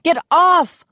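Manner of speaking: high loud pleading cry; wailed. A narrator's reading. Rising desperate yell. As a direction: Joe begs Moose off the table, loud wail. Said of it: "I wailed".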